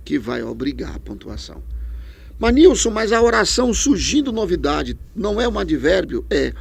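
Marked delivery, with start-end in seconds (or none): 2.4-6.3 lightly voiced student asking, higher; a hint of a puzzled student; imagined student. Teacher voicing a student's doubt, mildly puzzled.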